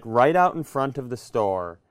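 ordinary talk, nothing marked

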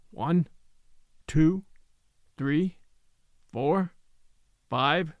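measured, evenly spaced count; slow counting. A narrator's reading. Little man counting a pulse aloud, slowly. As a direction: count slowly, pausing after each number. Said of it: "counting slowly".

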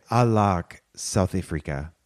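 put-on low, drawn-out go at a South African accent. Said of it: "That's your South African accent?".

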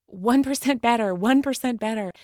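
mock boot-camp coach cheer; imitated coach's yell. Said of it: "he used to yell".

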